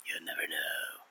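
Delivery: low, breathy, hushed reply; ominous whisper. Pretend. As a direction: tease a dark secret softly. Worm as ageless guru.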